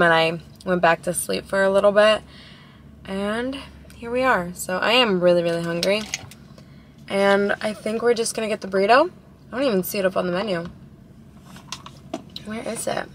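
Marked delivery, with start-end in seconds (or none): none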